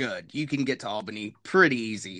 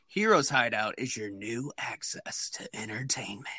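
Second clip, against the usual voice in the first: slick ad read, lower and quieter; announcer voice; 3 semitones lower, 5 dB quieter than usual. Pretend. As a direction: read it like a radio ad tag. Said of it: "I had to do that".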